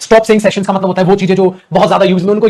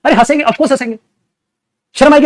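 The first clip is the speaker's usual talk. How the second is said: ordinary talk, nothing marked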